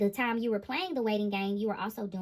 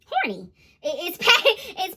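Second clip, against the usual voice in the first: embarrassed laugh; 7 semitones higher, 8 dB louder than usual. Real, not acted. Laughing at saying horny.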